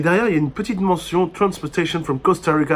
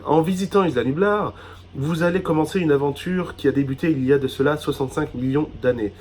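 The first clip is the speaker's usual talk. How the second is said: ordinary talk, nothing marked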